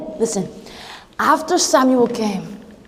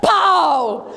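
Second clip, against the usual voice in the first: punch yell; 2 semitones higher than usual. Acted. Punching the lion.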